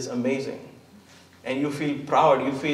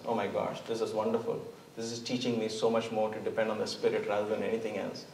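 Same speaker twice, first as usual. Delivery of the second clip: softer, awed and grateful; quiet gratitude; 4 semitones lower, 9 dB quieter than usual. Acted. Believer thanking God for a hard lesson, soft and awed. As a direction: hush it like a grateful realization.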